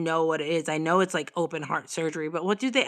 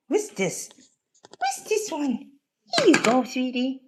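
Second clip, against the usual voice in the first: pet talk; 5 semitones higher than usual. Real, not acted. Feeding cat.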